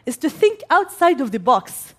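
ordinary talk, nothing marked

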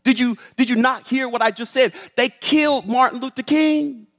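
high, distraught retort; imitating an upset, incredulous woman; acted anguished rebuke. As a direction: throw the comfort back in grief. Maybelle rebukes the child in grief.

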